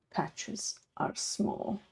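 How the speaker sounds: ordinary delivery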